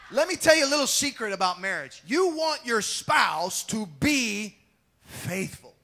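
full-voice pulpit delivery; preaching shout. Real, not acted. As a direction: call it out to the room. Preaching to congregation.

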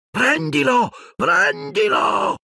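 put-on dark lord screeching at his servant to grab the boy; shrill shout. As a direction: shriek it again, desperate and furious. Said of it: "gridò di nuovo Voldemort con voce stridula".

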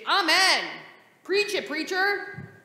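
high, loud, slow call-out; fervent amen. Shown as a demonstration. Pastor showing kids a church call-out, loud and high. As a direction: shout back at the preacher like a revival crowd. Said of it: "Some might say when the preacher is preaching".

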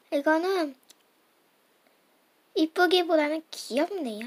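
ordinary delivery

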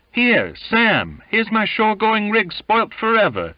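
high, loud wail of complaint; tearful cursing. A narrator's reading. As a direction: curse tearfully over your ruined gear. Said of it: "One blasphemed tearfully".

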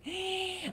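sharp, high indignant gasp; outraged gasp at a friend's indifference; shocked gasp. Real, not acted. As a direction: gasp as if scandalized. Outraged at the memory.

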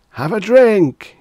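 slow, booming party shout. A narrator's reading. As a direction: yell it right into his ear. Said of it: "he shouted into his ear".

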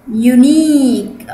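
"Unique" with a long, drawn-out ee; slow "Unique", lengthened second vowel; long I sound. Shown as a demonstration. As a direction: stretch the ee in "Unique". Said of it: "طولت في الآي هنا".

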